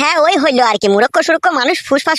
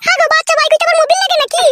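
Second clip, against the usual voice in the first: high-pitched scolding woman's voice; a sharp-tongued village wife cutting in; wife's voice. Acted. Village wife cuts in on the men, high-pitched and sharp.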